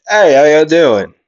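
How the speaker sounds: yelled greeting. Acted. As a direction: call out to the whole group. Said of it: "he would yell out and say".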